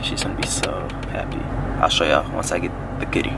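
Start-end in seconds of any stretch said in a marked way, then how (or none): 0.0-1.4 whisper. Real, not acted. Secret gift surprise.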